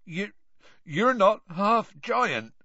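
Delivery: slow, croaky, high-pitched objection. A narrator's reading. Tearful gamekeeper objecting to the headmaster, croaky. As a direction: croak it out haltingly.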